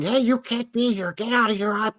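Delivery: high-pitched mock scolding; hammed-up high nagging threat; mock confrontational Karen. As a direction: imitate a pushy Karen ordering someone off. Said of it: "getting up in my face and saying".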